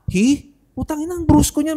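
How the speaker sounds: high-pitched mock disbelief; imitated puzzlement. Podcaster mimicking strangers puzzled by a pronoun, mock bewilderment. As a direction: act out a baffled onlooker, pitch up. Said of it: "magtataka eh".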